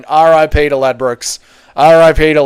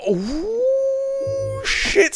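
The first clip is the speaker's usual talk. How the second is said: surprised ooh; 7 dB quieter than usual. Real, not acted. Reacting to the standings.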